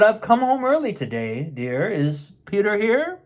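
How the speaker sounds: a child lightly playing a grown-up dad; mildly deepen it like a boy pretending to be father. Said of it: "Peter pretended that he was his father".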